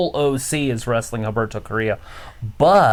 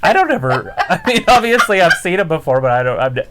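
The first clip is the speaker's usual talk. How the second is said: loud, high protest through laughter; laughing bafflement; 6 semitones higher, 5 dB louder than usual. Retold or imitated. Retelling stepdad's sex talk to friend, laughing bafflement. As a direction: crack up while retelling your baffled reaction.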